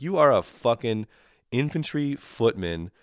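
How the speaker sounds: normal talking voice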